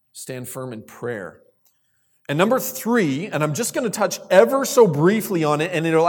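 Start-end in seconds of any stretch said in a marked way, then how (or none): 0.1-1.4 slow, soft emphatic repeat. Shown as a demonstration. Repeats point softly and slowly.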